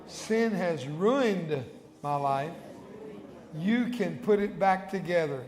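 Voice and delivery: slow, measured phrases with long pauses; solemn sinner's prayer, spoken clearly; led prayer. Real, not acted. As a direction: give each line slowly so the church can repeat it. Leads congregation in repeat-after-me prayer.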